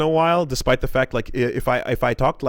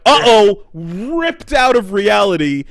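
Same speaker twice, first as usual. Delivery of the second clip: louder, higher mock panic; mock alarm; 6 semitones higher, 7 dB louder than usual. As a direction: play up a dramatic uh-oh for laughs. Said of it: "then it's like".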